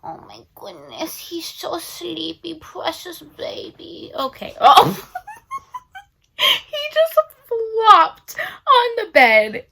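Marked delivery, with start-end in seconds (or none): 0.0-4.1 soft, slow cooing at the dog; tender pet voice. Real, not acted. Cooing over her sleepy dog. 6.4-9.7 slow, higher, through laughter; breaking into laughter; laughing while talking. Real, not acted. Laughing at the dog.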